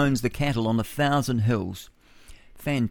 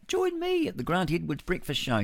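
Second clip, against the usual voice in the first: higher-pitched mock show plug; mock self-promotion; 7 semitones higher than usual. Host mocking a rival's plug for his show, mock promo voice. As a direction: lightly parody a host plugging himself. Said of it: "you don't see me doing that".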